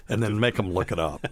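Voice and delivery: normal talking voice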